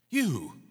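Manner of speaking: You high and loud; stress on You. Shown as a demonstration. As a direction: jab the word You at him, loudly. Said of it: "put the emphasis on the word you".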